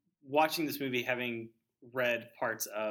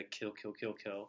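soft soundtrack whisper; 2 semitones lower, 7 dB quieter, slower than usual. Retold or imitated. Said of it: "you do hear the".

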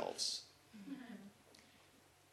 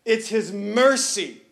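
full-force preaching; 5 semitones higher, 7 dB louder than usual. Acted. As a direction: preach it, building to the crowd's amens.

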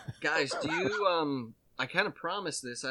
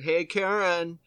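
mock jab, louder; 5 semitones higher, 7 dB louder, slower than usual. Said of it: "he used the Karen".

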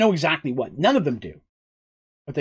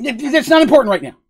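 flustered dismissal; 9 semitones higher, 5 dB louder, faster than usual. Acted. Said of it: "flustered reply".

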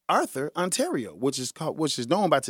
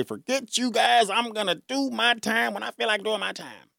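mock defiance; 7 semitones higher than usual. Voices defiant Indiana buddies.

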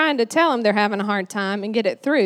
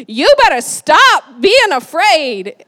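loud, slow, high mock telling-off; exaggerated stern yell, drawn out; mock scolding; 4 semitones higher, 7 dB louder, slower than usual. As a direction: scold hard, leaning on every word. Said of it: "instead of Jesus be like".

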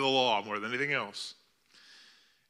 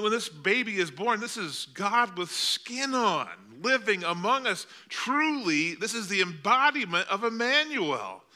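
raised, high preaching voice; preaching fervently; 8 semitones higher than usual. Acted. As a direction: build the point with fervour. Preaching at full force.

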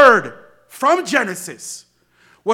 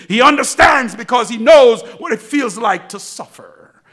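loud, ringing proclamation; preacher lifting his voice to the pews; preacher's shout; 2 semitones higher, 7 dB louder than usual. Acted. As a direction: shout it out to the church.

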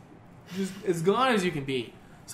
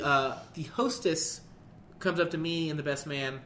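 ordinary delivery

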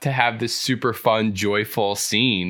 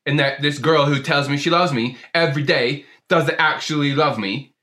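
put-on aggrieved rant in a British-Australian accent; 3 semitones higher than usual. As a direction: pour out the hurt in a broad Aussie-British accent. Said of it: "a really good accent".

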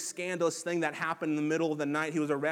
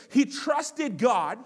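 slow, louder sneering jab; slow mockery; 5 semitones higher, 6 dB louder, much slower than usual. A narrator's reading. Priests mocking Jesus' trust in God, slow sneer. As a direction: drag each word out in mockery. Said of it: "the heart of their mockery".

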